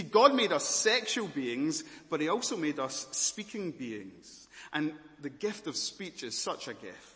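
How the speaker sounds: ordinary talk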